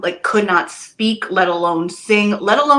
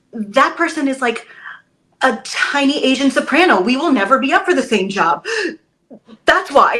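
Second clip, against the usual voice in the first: amused matter-of-fact reply; 6 semitones higher, 6 dB louder than usual. Retold or imitated. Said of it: "And I'm like".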